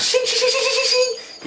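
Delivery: high, quick "shing" sounds like blades slicing; sword swish noises. Retold or imitated. Describing Hakuro running with his sword, high sword-swipe noises. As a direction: make the sword whip through the air.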